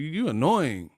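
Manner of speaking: ordinary delivery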